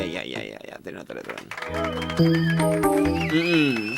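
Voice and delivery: ordinary talk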